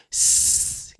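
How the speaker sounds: S held as one long hiss; long S sound. Shown as a demonstration. Teacher demonstrating the beginning of the sound, long S. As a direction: stretch out the S that begins C. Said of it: "kailangan medyo mahaba eh".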